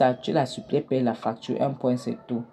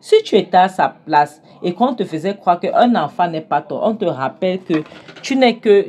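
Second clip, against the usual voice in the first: loud, higher, heated telling-off; angry scolding; 4 semitones higher, 7 dB louder than usual. Acted. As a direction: scold him hard, voice rising. [name] scolds Jonathan.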